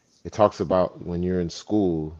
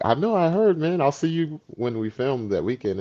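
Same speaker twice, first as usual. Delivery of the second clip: friend reassuring him; 9 semitones higher than usual. Retold or imitated. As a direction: brush off old news, voice pitched up. Retold friend already knows the news.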